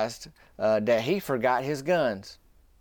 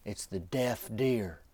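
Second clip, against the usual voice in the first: hushed whisper; 2 semitones lower, 7 dB quieter, slower than usual. Retold or imitated. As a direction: lean in and murmur it slowly. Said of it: "Granddaddy whispers".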